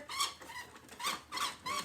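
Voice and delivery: very high, slow, piping tone; squeaky high voice. Pretend. Teacher playfully grabbing the big clock, squeaky high.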